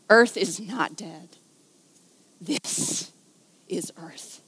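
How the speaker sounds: slow, then fading into a whisper; reverent whisper. Acted. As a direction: breathe the last words in awe. Applying the line to earth.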